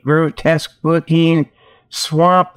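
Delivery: usual speaking voice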